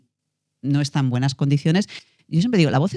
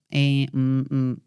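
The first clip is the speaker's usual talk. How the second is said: hesitant fillers; much slower than usual. Shown as a demonstration. Shows listeners what fillers sound like, hesitating. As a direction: demonstrate hesitating with drawn-out filler hums. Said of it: "titubear o hacer fillers".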